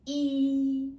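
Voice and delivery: E held loud, high and level; high flat tone. Shown as a demonstration. Teacher counts together with pupils, high flat tone. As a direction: keep E up on one note. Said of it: "flat tone is a high-pitched tone".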